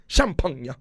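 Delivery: Champagne said high and loud as "sham-PAHN-ya"; mock-fancy pronunciation. Repeats the showy mock pronunciation.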